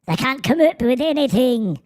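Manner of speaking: high mock Irish lilt; squeaky-small hammed-up Irish accent; Irish little person. Streamer redoing the accent on request, mock Irish little person. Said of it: "like an Irish little person".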